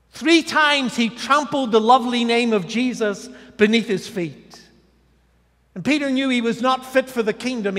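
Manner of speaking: loud, rising pulpit delivery, each line hammered; full-force preaching. Acted. Pastor preaching Peter's failure to the congregation, loud and rising. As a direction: preach it out hard over the congregation.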